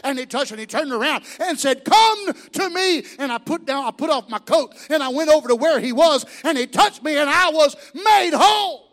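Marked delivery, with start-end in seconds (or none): 1.8-3.1 Jesus calling. Acted. Jesus calls the beggar.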